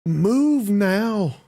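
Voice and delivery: high, loud warning shout; urgent yell. A narrator's reading. Game master voicing Kristen yelling at Doc, urgent shout. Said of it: "she just yells at you".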